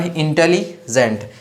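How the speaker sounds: Intelligent broken as Intelli, pause, gent; syllable split. Shown as a demonstration. Teacher marking the two syllable groups, Intelli-gent split. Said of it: "यहाँ पर एक syllable हो गया".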